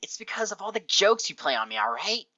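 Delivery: high, exasperated character voice; cranky pony losing patience; acted outburst. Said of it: "You flinch back at her outburst".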